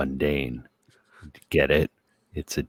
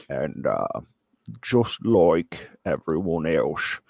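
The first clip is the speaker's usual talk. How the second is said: hammed-up, wobbly Aussie accent; exaggerated Australian accent. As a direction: lecture in a badly faked Australian accent. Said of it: "in an exaggerated Australian accent".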